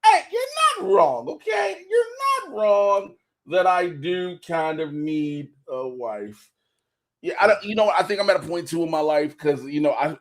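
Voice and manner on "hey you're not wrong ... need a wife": hammed-up wail, much higher and slow; mock wailing shout. Joking to co-host about needing a wife, mock wailing shout. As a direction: howl it like a mock lament, drawn out.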